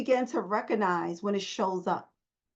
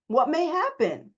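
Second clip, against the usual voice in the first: ordinary talk, nothing marked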